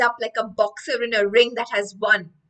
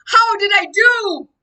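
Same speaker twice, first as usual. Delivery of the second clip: big high booming voice, much louder; sonorous booming voice; 10 semitones higher, 9 dB louder than usual. Acted. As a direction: boom it like a proud giant. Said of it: "she talks in a sonorous voice again".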